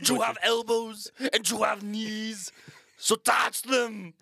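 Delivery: bad German accent. Co-host joining in a hammy German accent. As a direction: bark it in a comic German accent. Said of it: "our attempt at German accents".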